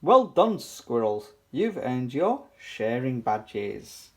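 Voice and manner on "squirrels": plain reading voice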